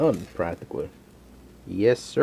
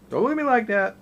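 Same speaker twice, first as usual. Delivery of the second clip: much higher and louder playful protest; pleading with the dog; 12 semitones higher, 5 dB louder, faster than usual. Real, not acted. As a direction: give in to the begging dog, voice going way up. Dog begging for food.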